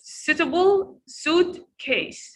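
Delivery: Suitable suitcase, slow, first syllables leaned on; stress on first part. Shown as a demonstration. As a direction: hit SUIT in both words slowly. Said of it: "We stress in the first part".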